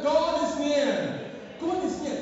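emphatic repeat. Shown as a demonstration. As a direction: call it out again so the whole church hears. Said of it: "Have I, have I lost my people?".

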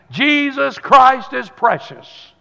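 defiant preacher's shout; loud declaration. Retold or imitated. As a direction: proclaim it with full voice. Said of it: "said loudly".

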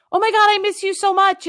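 high, gushing mock sincerity; gushing quote. Mocking people who gush on Facebook, high gushing voice. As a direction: gush it like a fake friend. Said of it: "just says, like".

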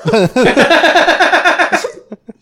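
long loud real belly laugh; loud laughter. Real, not acted. Laughing hard at his own zoo joke, loud.

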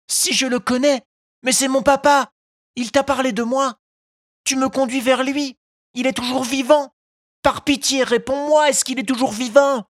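put-on high, eager child's voice begging; urgent, hopeful Pinocchio pleading; pleading puppet boy. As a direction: puppet begging the pigeon for news of his papa. Pinocchio begs news of father.

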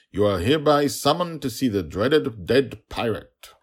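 loud, high official summons; pompous guard. Acted. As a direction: announce it like a stiff herald. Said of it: "pirate prison guard came".

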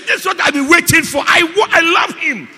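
high, shouted outpouring; loud, high preacher's shout; impassioned preaching. Acted. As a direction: cry it out as the overjoyed disciple.

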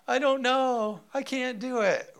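put-on high, whiny little-kid protest; whining child. Father mimicking child refusing seatbelt in car. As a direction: whine that you can't buckle up.

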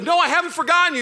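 high, loud mock yell of the young lion; hammed-up shouting prince; shouted denial. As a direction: yell it back at your father. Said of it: "He's like".

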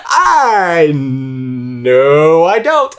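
drawn-out, high comic cry; mock wail. Player reacts to baffling lyric clue, mock wailing. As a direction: wail the denial in a long swooping whine. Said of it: "I was like".

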